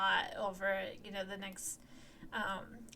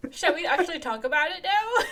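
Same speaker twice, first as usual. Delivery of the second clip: loud, higher, through laughter; laughing; 7 semitones higher, 13 dB louder than usual. Real, not acted. Co-host steering back to the show after his bit, laughing. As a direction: ask it while cracking up.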